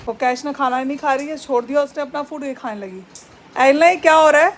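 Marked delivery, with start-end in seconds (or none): 3.6-4.5 loud sharp call at the pet; scolding call. Real, not acted. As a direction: catch the thief in the act. Scolds other pet eating food. (ज़ोर से डाँटते हुए पुकारना)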